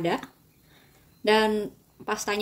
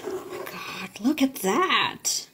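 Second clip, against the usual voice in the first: awed; much slower than usual. Real, not acted. Admiring food slowly.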